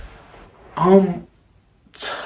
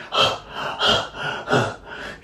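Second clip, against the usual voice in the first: quick voiceless puffs of breath; running pants; 8 dB quieter than usual. Retold or imitated. Storyteller acts out his brother running off, panting. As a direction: imitate someone panting on the run.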